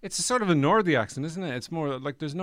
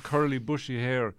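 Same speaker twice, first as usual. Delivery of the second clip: usual speaking voice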